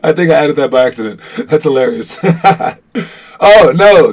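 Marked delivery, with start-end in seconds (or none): 3.4-4.1 real surprise. Real, not acted. Spotting a mistake.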